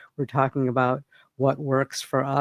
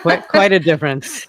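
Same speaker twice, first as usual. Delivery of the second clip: real laugh, louder, breaking through the words; 4 semitones higher, 8 dB louder than usual. Real, not acted. Host laughing at the price gap to her guest, a real laugh. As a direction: chuckle it out in amused disbelief.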